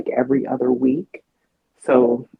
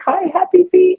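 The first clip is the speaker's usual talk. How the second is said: much higher, sugary hello; sweet greeting; 4 dB louder than usual. Retold or imitated. Woman greeting the neighbor girl in her head, much higher. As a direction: greet the child like she's precious. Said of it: "I'm always like".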